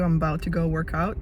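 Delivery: ordinary talk, nothing marked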